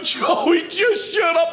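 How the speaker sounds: sister yelling. Retold or imitated. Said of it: "My sister's in the other room going".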